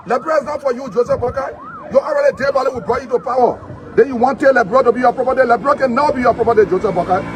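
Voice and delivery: loud, insistent political attack; real forceful accusation; angry tirade. Real, not acted. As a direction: hammer it out loudly at the president. Tirade addressed straight at the president.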